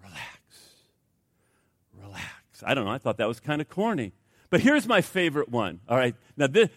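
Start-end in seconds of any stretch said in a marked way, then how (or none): 0.0-2.6 much lower, far softer repeated calming murmur; soothing relax. Shown as a demonstration. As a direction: soothe the spouse with a breathy word. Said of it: "and just go".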